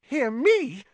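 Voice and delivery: squeaky, loud panic; very high, incredulous squeal; unnaturally high voice. A narrator's reading. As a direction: let fear send the voice way up. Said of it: "in an unnaturally high voice".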